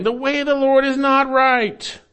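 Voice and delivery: quoted high in a scornful mock whine; mocking complaint. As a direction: mimic the people's whining accusation. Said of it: "They're saying".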